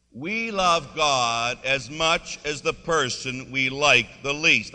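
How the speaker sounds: slow, loud, every word drawn out; congregational recitation. Shown as a demonstration. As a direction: lead the room in saying it together, slowly and firmly. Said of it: "want everybody to say that with me".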